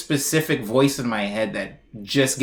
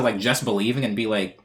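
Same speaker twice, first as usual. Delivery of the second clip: normal talking voice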